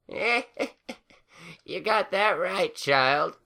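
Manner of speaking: high cackling laugh through the words; old mare chuckling. A narrator's reading. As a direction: laugh it out like a tickled granny. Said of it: "the chuckling equine".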